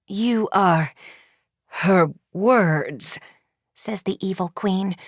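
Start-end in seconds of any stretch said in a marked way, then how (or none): 0.1-3.2 slow words forced through clenched teeth; gritted teeth. Acted. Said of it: "slowly and through gritted teeth".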